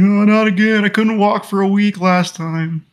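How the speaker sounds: very high falsetto protest; squeaky-high put-on voice of a cowed man; mock whimpering husband. Imitating a husband scared of his wife, falsetto mock whimper.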